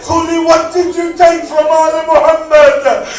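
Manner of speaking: loud, high wailing sermon cry; preaching at full force. Acted. Majlis recitation at full force.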